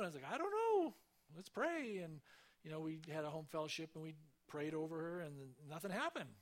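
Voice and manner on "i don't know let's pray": anxious outburst. Retold or imitated. Panicked sister asks what to do.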